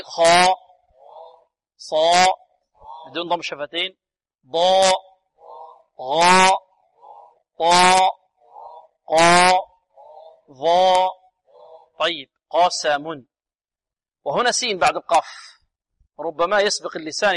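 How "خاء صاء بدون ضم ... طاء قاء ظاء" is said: each heavy letter said loud and drawn out with a long aa; emphatic letters drill. Shown as a demonstration. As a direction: pronounce each emphatic consonant loudly for the class to repeat. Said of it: "انطقوا معي". (نطق الحروف المفخمة بصوت عالٍ وممدود)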